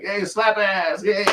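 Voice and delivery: very high, slow mock voice; Key and Peele skit voice. Quoting a comedy sketch, very high and slow. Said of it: "Key and Peele skit".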